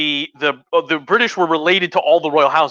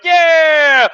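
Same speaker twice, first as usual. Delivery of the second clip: crowd cheer; 12 semitones higher, 7 dB louder than usual. Retold or imitated. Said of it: "all the British people are like".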